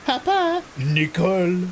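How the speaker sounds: very high, slowed Oh Papa, Oh Nicole; Renault ad voices. Retold or imitated. Reenacting the Renault Clio advert for chat, very high and hammed. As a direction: ham up the old car advert. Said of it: "It's a car advert".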